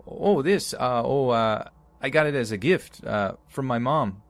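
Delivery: put-on nervous, high Canadian accent; stammering Canadian accent. A narrator's reading. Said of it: "In the best Canadian accent my uncle could conjure up, he stammered".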